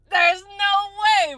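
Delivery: way pitched high and drawn out slowly; high, slow, incredulous there's no way; stunned disbelief. Real, not acted. Reacting to the clip, high and stretched in disbelief.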